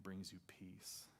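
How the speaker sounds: slow whisper to the congregation. Acted. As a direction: let it fall to a hushed whisper. Tender close on God's peace.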